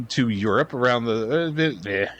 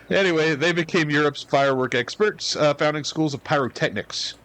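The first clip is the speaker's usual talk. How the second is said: ordinary delivery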